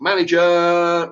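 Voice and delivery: high, drawn-out call; screaming for the manager. Retold or imitated. Customer demanding someone in charge, screaming. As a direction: stretch the word into a yell.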